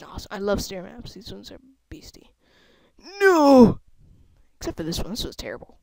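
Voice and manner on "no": yelled no, much louder; a sudden cry of loss; yelped no as the floor gives way; loud cry of dismay. Real, not acted. Character dies.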